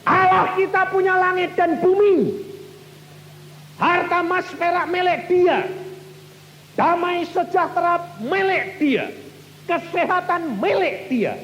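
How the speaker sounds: high, loud sermon proclamation; full-force preaching. Acted. Preacher proclaiming to the congregation, loud and high.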